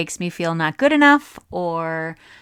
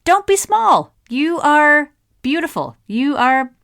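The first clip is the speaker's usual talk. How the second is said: much higher, loud, emphatic hype; pep-talking influencer; 9 semitones higher, 7 dB louder than usual. Retold or imitated. Imitating an inspiring woman online urging followers on, emphatic pep-talk voice. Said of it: "but she's like".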